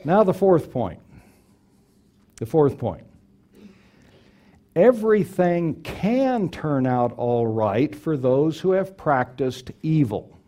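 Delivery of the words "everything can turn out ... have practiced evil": "can" leaned on and drawn out; slow line with heavy weight on "can"; stress on CAN. Real, not acted. Preacher offering hope to those who practiced evil, stress on "can". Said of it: "I emphasize the word can".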